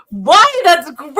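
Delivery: put-on high, loud grossed-out kid; imitating a daughter's loud disgust; disgusted little girl. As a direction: whine it like a kid who finds it gross. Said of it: "And she goes".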